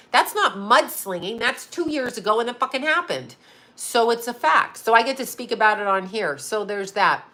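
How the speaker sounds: ordinary talk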